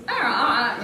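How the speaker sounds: loud, high, irritating "ah" noises; harsh mock squawk; grating voice. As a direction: imitate an annoying navigation voice. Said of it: "this grating voice".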